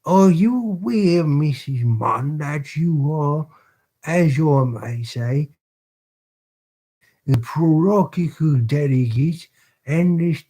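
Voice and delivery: hammed-up British accent for a pompous beadle. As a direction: puff yourself up like a self-important parish beadle. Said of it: "the extremely annoying accents".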